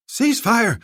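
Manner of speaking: first call to stop; shouted order. Acted. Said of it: "Zolkadar shouted".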